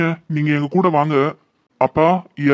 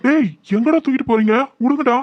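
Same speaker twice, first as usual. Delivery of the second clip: raised, higher pitched cries of protest; protesting shout; 6 semitones higher than usual. Acted. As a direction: yell at the kidnappers to let go. Being dragged away, protesting.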